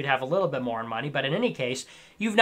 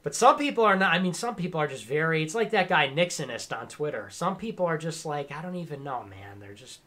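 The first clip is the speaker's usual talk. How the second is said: normal talking voice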